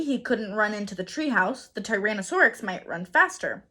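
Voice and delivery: plain reading voice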